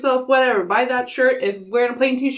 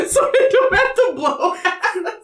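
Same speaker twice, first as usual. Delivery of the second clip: slow, much higher line through laughter; laughing; 9 semitones higher, slower than usual. Real, not acted. Friend giggles the punchline about the pizza, laughing. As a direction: giggle it out slowly.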